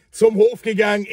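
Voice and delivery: ordinary talk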